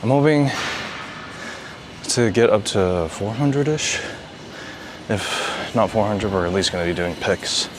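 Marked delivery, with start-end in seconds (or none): 0.0-6.1 winded, panting between words; out of breath. Real, not acted. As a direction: talk while catching your breath. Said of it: "Sorry, I gotta catch my breath".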